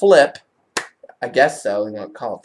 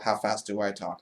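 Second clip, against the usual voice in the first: ordinary talk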